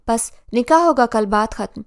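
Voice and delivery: loud, final decree; forceful declaration. A narrator's reading. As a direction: announce it loudly, ending all discussion. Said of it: "لاٹھی زمین پر مار کر اعلان کیا". (بلند آواز میں حتمی اعلان)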